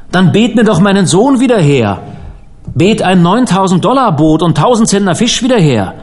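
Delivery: high, overwrought challenge; bitter anguish. A narrator's reading. Bereaved man dares preacher to pray back son, bitter anguish. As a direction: throw the demand at the preacher, pitch falling away at the end.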